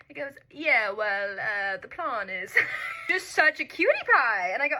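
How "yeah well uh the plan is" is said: lightly imitated London accent, easy and relaxed. Quoting her date's voice memo in a gentle London accent. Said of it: "the most beautiful London accent".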